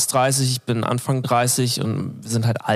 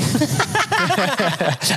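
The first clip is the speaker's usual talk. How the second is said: high laugh; 12 semitones higher than usual. Real, not acted. Laughs at own contradiction.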